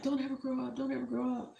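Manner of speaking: high, soft, a hint of pleading to a little one; tender plea. Speaking to her little daughter, lightly tender and soft. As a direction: softly beg her not to grow up. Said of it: "I actually said that to my daughter".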